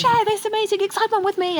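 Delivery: hammed-up thrilled parent voice; gushing enthusiasm. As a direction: overdo the wide-eyed excitement. Parent gushing over nature.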